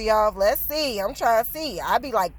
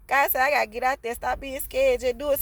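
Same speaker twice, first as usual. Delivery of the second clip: ordinary talk, nothing marked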